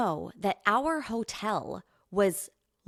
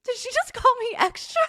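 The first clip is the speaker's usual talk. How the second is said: incredulous laughing disbelief; 5 dB louder than usual. Retold or imitated. Stunned at the stranger's remark.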